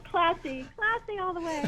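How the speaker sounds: high, amused, laughing through the words. Real, not acted. Guest joking about being named after a racetrack, laughing. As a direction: tease her own odd name with a giggle.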